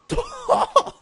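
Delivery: high squealed yes; shouted protest. Real, not acted.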